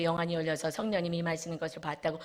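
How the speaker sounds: ordinary delivery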